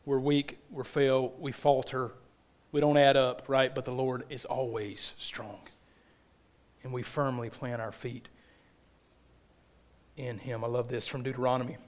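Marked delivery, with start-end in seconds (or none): 3.7-10.6 breathy hushed whisper of faith. Real, not acted. Preacher to congregation, a hushed whisper. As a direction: drop to a whisper to draw them in.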